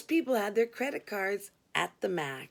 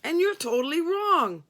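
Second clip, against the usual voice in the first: louder, higher insistence; firm declaration; 8 semitones higher, 6 dB louder than usual. A narrator's reading. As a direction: insist he's wrong. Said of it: "he declared".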